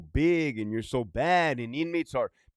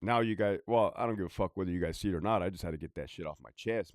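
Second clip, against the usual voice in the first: ordinary talk, nothing marked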